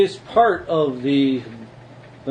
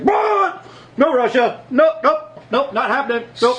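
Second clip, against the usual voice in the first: mock denial; 6 semitones higher than usual. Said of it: "some people like".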